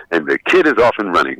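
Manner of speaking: usual speaking voice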